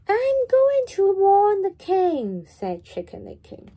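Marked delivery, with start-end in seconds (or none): 0.1-2.4 high and loud, breathless urgency; urgent chick. Acted. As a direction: blurt it out in a hurry. Panicked chick announces plan.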